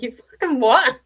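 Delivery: loud, high incredulous snap; indignant retort. Retold or imitated. Mum snapping back at the pushy door knocker, loud and indignant. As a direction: hit it like Mum losing patience.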